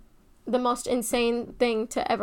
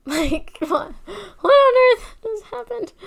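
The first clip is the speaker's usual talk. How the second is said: much higher, louder amazed cry; amazed disbelief; 11 semitones higher, 10 dB louder than usual. Real, not acted. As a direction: burst out in total astonishment. Reacting to father alive.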